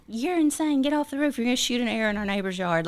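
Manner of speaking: a retold, lightly voiced mom, then rushing the warning; mom scolding. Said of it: "my mama was like".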